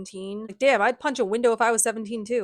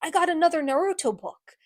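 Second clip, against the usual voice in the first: lightly voiced eager kid, higher pitch; 7 semitones higher than usual. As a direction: pipe up like an excited little boy. Excited child calls to Clary.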